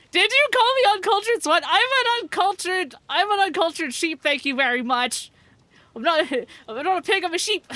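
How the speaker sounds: high, loud, mock-offended squeal; mock indignation. Streamer squeals at chat, mock offended, high and loud. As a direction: play up the offence at chat for laughs.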